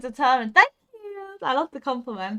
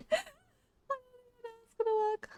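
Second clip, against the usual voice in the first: high, fast, squeaky laughing words; laugh-crying; 12 semitones higher, 9 dB quieter, much faster than usual. Real, not acted. Streamer giggles while straining over a hard jump, high and fast.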